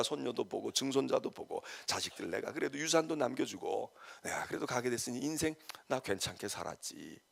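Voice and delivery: low, soft voice looking back on life; dying person's reflection. Acted. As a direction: speak quietly, as if summing up your life at the end. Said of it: "죽을 때 가서". (임종을 앞두고 나직이 회고하듯)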